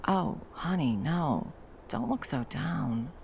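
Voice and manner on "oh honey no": ordinary delivery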